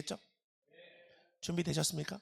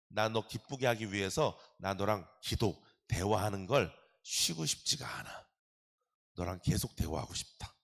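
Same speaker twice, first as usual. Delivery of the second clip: tender voice of God; 6 semitones lower, 7 dB quieter than usual. Acted.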